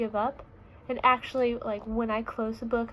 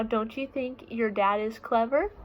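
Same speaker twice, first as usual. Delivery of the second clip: ordinary delivery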